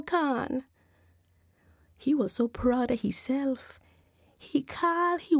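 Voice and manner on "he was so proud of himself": plain reading voice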